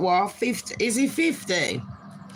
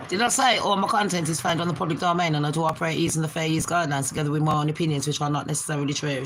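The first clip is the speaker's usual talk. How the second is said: rattled-off disclaimer; rapid, run-together recital; too fast; 2 semitones lower, much faster than usual. Real, not acted. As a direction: race through it like small print. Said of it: "Do I talk too fast".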